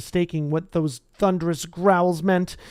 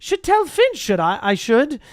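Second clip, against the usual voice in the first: high, falsely pious poltergeist; saintly voice; 8 semitones higher than usual. Acted. Peeves threatening to tell Filch, sweetly high. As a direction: play innocent while plotting mischief. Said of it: "said Peeves in a saintly voice".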